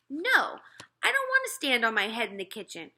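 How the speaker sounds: put-on older brother refusing his mother, firmly; boy refusing. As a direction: put your foot down like a fed-up boy. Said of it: "I added".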